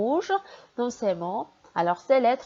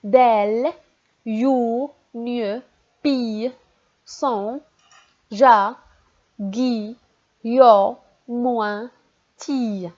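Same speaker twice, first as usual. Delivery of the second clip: syllables read out one by one, some much louder; syllable reading drill; 4 dB louder, much slower than usual. Shown as a demonstration. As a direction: call out each syllable for the class. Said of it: "On descend la ligne".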